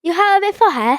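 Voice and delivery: high, laughing British accent attempt. Teen retries the British accent insult on his sister. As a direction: ham up a British put-down, giggling through it. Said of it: "Do it again, [name]".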